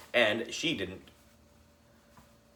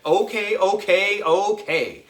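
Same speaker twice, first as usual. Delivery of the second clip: exasperated giving in; 6 semitones higher, 6 dB louder than usual. A narrator's reading. AJ gives in exasperated.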